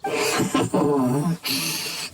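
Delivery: low, breathy menacing laugh; villain's chuckle. Acted. Villain surprising his foes, menacing chuckle. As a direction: chuckle darkly from the shadows.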